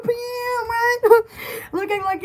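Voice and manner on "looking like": normal talking voice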